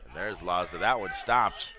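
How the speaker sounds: loud, emphatic play-by-play burst; excited save call. Real, not acted. Live call of a big save.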